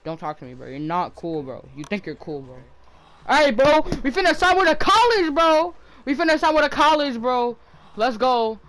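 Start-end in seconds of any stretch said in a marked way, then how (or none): 3.3-5.7 loud, high hype yell; excited shout. Real, not acted. Hyped about signing with college. 6.1-8.6 excited. Real, not acted. Continues hype about signing.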